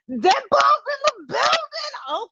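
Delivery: high, drawn-out singsong whoop; hyped shout-out. Real, not acted. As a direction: draw it out high to hype the gifter. Host hypes a viewer's gift.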